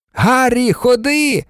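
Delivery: high, cheerful shout; calling out. A narrator's reading. George summoning Harry from the crowd, shouting. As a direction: call him over, pitched high. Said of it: "крикнув Джордж".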